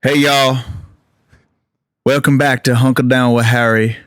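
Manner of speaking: talk-show host's laid-back Southern voice; [name] accent. Retold or imitated. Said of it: "Here it is".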